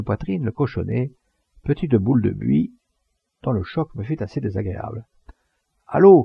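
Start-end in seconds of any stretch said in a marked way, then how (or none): 5.9-6.2 loud, high jeering yell; crowd shout. Acted. The crowd shouts down the speaker, loud yell. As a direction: bellow it across the square. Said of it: "cria-t-on de tous côtés".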